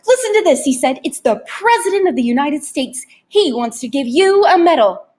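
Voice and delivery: high, loud, breathless sales pitch; excited tempter Rictus. Acted. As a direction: hype the fake phone call. Narrator voices Rictus on phone.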